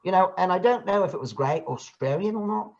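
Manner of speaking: Australian accent in the back of the throat; demonstrated back-of-throat Australian accent. Shown as a demonstration. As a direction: slip into Australian, placed far back. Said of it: "keeping my accent in the back of my throat".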